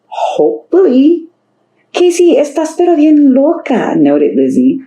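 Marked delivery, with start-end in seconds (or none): 0.1-1.3 dreamy hopeful voice. Acted. Casey dreamy, high slow. 1.9-3.9 put-on teasing girl voice. Lizzie teasing in Spanish.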